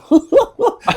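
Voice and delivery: a sudden high-pitched burst of laughter; imitating the Gunny cracking up; high cackling laugh. Gunny finds the story hilarious, high cackling laugh. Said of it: "he's just like".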